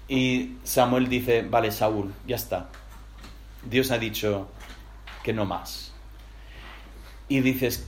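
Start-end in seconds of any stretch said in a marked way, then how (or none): 1.4-5.9 quiet final verdict. Acted. Samuel ends the matter.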